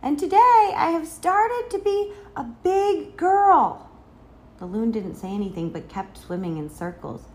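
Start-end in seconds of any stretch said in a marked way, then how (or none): 0.0-3.9 put-on high, loud, slow child's announcement; big-girl boast, pitched high; proud calling out. A narrator's reading. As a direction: call it out proudly, stretching the words.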